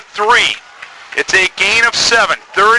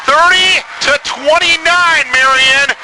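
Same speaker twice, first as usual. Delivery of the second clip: excited score call; 7 semitones higher, slower than usual. Real, not acted. Score read right after touchdown.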